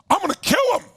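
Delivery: high-pitched furious imitation; imitating enraged partner. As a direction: play the passionate friend blowing up. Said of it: "the one who's really passionate".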